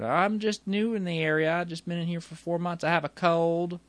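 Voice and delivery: high-pitched Southern newcomer; southern accent. Retold or imitated. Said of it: "He has this southern accent".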